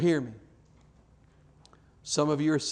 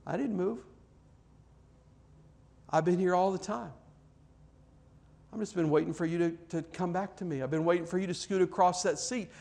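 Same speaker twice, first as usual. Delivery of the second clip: higher, softer, tender reassurance; gentle God voice; 3 semitones higher, 4 dB quieter, faster than usual. Retold or imitated. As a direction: coax them back gently.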